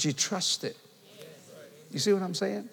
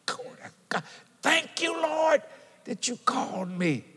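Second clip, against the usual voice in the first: shouting praise; 9 semitones higher, 4 dB quieter than usual. Retold or imitated. Young preacher praising God after his hardship. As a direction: shout thanks to God, voice leaping high. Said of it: "my faith was shouting".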